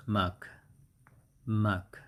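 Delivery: MUK as a short isolated syllable, repeated; sounded-out pronunciation. Shown as a demonstration. Teacher breaking down the pronunciation of stomach, isolated syllable. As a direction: give MUK alone, twice, with a pause between. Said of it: "And the pronunciation is".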